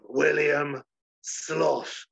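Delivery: very slow, drawn-out sloth; slow pompous sloth. Acted. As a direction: savor the name grandly.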